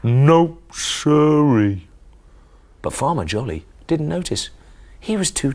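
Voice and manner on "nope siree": loud cow drawl on siree; Cow's voice. Acted. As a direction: play the stubborn farm cow. Cow's catchphrase voice.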